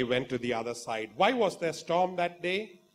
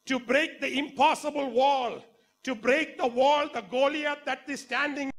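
preaching at full force; 11 semitones higher, 4 dB louder than usual. Acted. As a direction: preach it out to the whole church.

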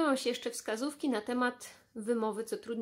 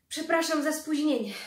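breathless, high and louder apology; panting apology; 6 semitones higher, 5 dB louder than usual. Acted. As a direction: gasp it out after rushing in. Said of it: "wysapał". (zdyszane przeprosiny)

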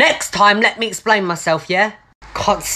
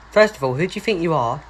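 ordinary delivery

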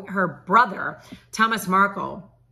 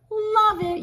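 squealed "Love it." much higher; gushing delight; 5 dB louder than usual. Real, not acted. Host gushing about [name]'s new line, high excited squeal.

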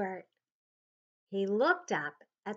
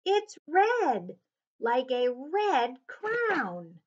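delighted; 4 semitones higher, 6 dB louder, much slower than usual. A narrator's reading. Marta admiring the bird.

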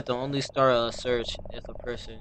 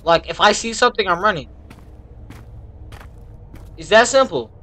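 higher and louder; nervous outburst; 6 semitones higher, 8 dB louder than usual. Real, not acted. Gamer warning himself he'll flee, loud and jumpy. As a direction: declare it on edge.

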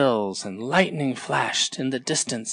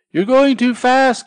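much higher, loud call after a flying bird; 12 semitones higher, 10 dB louder than usual. Acted. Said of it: "called Tortoise".

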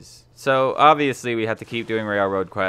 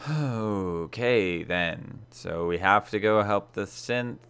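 usual speaking voice

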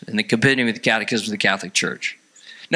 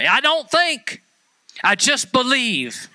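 high, drawn-out caricature of naive faith; mock simple believer; 11 semitones higher, slower than usual. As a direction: stretch it out high and slow, mocking. Mimicking an unthinking believer.

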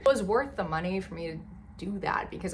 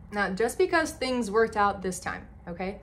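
ordinary talk, nothing marked

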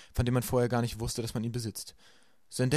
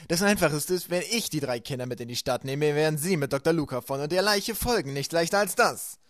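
Stefano persuading; 5 semitones higher, 6 dB louder than usual. A narrator's reading. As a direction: sell the plan smoothly.

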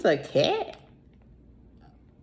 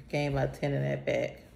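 ordinary talk

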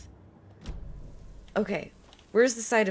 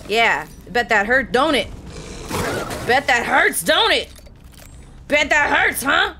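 taunting; 6 semitones higher, 8 dB louder than usual. Acted. Taunting the shot wolf.

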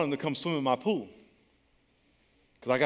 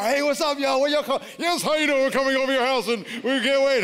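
bright, loud, high friendly hello; cheery greeting; 9 semitones higher, 6 dB louder than usual. Retold or imitated. Pastor greets kids in the car, loud cheer.

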